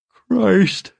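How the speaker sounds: said mid-yawn, high; words stretched by a yawn. Acted. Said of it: "in the middle of a yawn".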